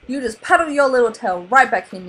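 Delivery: loud, stern scolding; yelling father. A narrator's reading. Father scolding son at sea, loud stern yell. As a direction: order him back. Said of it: "Marlin yelled".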